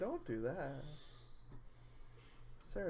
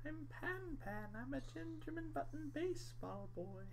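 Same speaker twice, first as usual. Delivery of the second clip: Pan Pan voice; 11 semitones higher, 8 dB quieter than usual. Retold or imitated. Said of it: "It's a Monster Factory thing".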